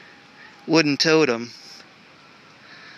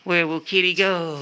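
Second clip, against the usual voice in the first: slow, higher cooing question to the kitty; 4 semitones higher than usual. Real, not acted. Baby talk to cat.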